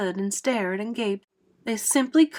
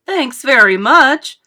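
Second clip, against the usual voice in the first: loud, drawn-out, higher-pitched retort; sarcastic snap; 4 semitones higher, 12 dB louder, slower than usual. A narrator's reading. Fire chief refuses the ladder offer, snapping sarcastically. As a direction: snap back with indignant sarcasm. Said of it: "snapped the head of the fire department".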